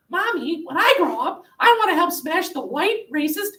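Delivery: put-on high sugary kid voice, proud of her words; little girl Sally. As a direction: chirp it like an eager small child. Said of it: "says Sally".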